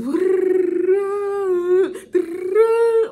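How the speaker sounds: loud high-pitched mock wail; imitated hysterical crying. Imitating the woman on the phone, hysterical wailing. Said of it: "this woman is crying hysterically".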